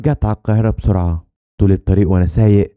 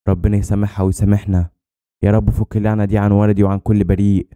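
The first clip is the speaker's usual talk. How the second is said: ordinary delivery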